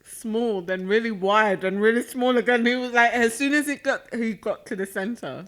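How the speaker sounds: talking through a mouthful; words round a bite of food; mouth full. Real, not acted. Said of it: "I'm talking with my mouth full".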